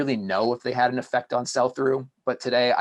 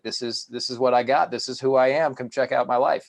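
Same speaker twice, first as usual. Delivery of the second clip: louder, higher bragging voice; showing off; 4 semitones higher, 5 dB louder than usual. Retold or imitated. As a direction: brag to your friends. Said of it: "you were showing off".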